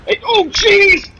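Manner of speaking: high, loud yelp of alarm; startled yelp. Real, not acted. Player jolted by the game, startled yelp. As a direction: cry out high and drawn out in shock.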